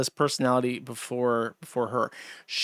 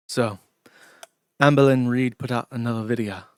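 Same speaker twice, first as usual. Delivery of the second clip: a mock Scottish YouTuber, lower and dour; slightly lower, gloomy Scottish accent, imitating a commentator; 4 semitones lower than usual. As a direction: do him in a downtrodden Scottish accent, deadly serious. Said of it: "this really dour, downtrodden way of speaking with, like, a Scottish accent".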